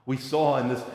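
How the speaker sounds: ordinary talk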